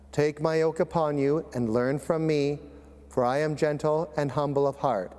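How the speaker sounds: ordinary delivery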